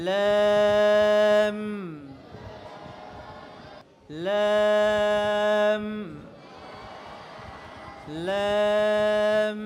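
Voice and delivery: long "লাম" with fronted vowel, lips pressed on final m; drawn-out model "লাম", closing firmly on m; thin lam. Shown as a demonstration. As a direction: stretch each "লাম" light and level into a hum. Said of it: "লাম বলার সময় লাস্টে ঠোঁটকে একটু চাপ".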